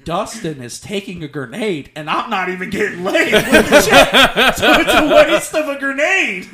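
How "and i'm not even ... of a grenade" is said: higher, louder words laughed out; laughing. Real, not acted. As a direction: laugh through the punchline. Laughing at his own story.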